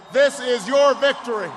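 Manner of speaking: loud, ringing cry to the crowd; rousing shout. Real, not acted. President-elect to the cheering rally crowd, rousing shout. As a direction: lift the crowd with it.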